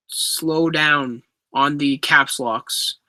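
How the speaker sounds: usual speaking voice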